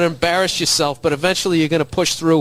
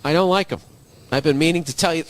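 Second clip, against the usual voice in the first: ordinary delivery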